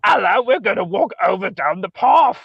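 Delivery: hammed-up posh British accent. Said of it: "all this aristocratic".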